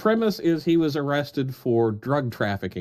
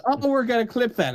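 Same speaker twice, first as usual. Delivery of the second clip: mock quote; 9 semitones higher, 4 dB louder than usual. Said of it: "the best part is".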